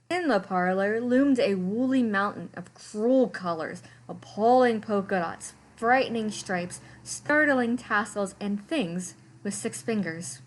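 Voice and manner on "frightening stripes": ordinary delivery, nothing marked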